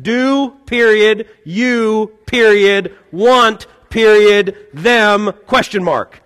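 each word set apart by a spoken period; clipped, one word at a time like a terse text; slow, deliberate dad reading out punctuation; punctuated words. Retold or imitated. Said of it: "in my dad's own subtle way".

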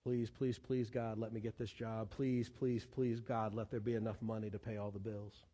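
soft, low repeated pleas; serious pleading prayer. Acted. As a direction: pray quietly and low, begging. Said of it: "we find ourselves going".

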